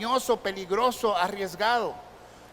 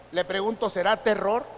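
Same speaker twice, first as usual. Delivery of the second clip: normal talking voice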